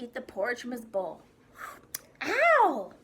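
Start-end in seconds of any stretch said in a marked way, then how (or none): 2.2-2.9 high, loud pretend yelp of pain; exclaimed ow. A narrator's reading. Albert pretending the porridge burns, exclaimed loudly. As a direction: cry out as if burnt by hot food. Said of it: "Albert exclaimed".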